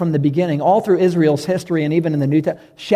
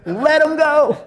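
Jesus urging, lifted up high; Jesus insisting; 9 semitones higher, slower than usual. Retold or imitated. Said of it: "And Jesus is like".

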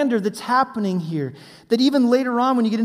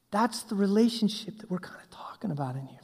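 whisper; 7 dB quieter than usual. Real, not acted. Preacher drops to hushed emphasis.

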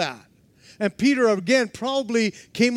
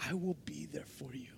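whisper; 5 semitones lower, 16 dB quieter than usual. Acted. Jesus's promise whispered the final time.

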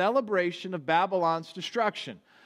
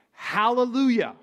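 higher, louder hallelujah, full shout; shouting praise; 5 semitones higher, 5 dB louder than usual. Retold or imitated. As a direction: shout it as the multitude in heaven.

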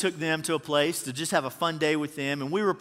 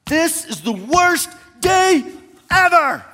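put-on much higher, louder, slow whiny kid voice; whiny child; 10 semitones higher, 7 dB louder, slower than usual. Father imitates his son whining after a fun day, whiny child voice. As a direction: stretch it out like a sulking little boy.

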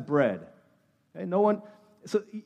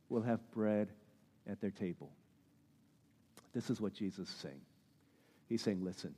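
hushed sermon voice; 10 semitones lower, 14 dB quieter than usual. Acted. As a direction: draw them in with a very soft voice. Preacher drops to a hushed close.

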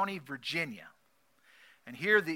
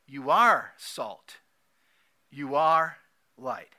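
"You are salt. You are light." with a slow, heavy beat on "are"; slow emphatic declaration; 7 dB louder, much slower than usual. Shown as a demonstration. Preacher insists to the congregation, slow and emphatic. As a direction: plant "are" firmly, one slow word at a time. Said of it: "the scripture says this".

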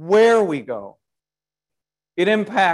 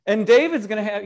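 normal talking voice

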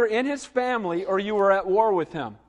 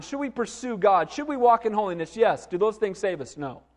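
normal talking voice

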